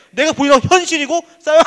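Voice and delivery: high, loud and forceful. Shown as a demonstration. Preacher voicing the noise of reality, loud. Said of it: "이 목소리는 엄청 큰데".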